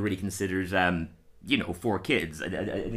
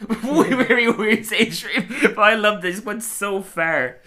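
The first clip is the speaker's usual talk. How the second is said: slow, higher amused words; giggling, much higher; laughing; 11 semitones higher, 6 dB louder, slower than usual. Real, not acted. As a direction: laugh through it. Laughing remark.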